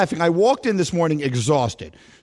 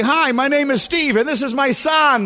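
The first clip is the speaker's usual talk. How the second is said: mock introduction; 9 semitones higher than usual. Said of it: "You don't walk into a broom and say".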